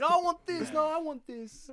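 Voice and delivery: put-on high, loud bratty kid voice; whiny child. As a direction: squeal it like a spoiled kid. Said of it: "playing up the whole childhood thing".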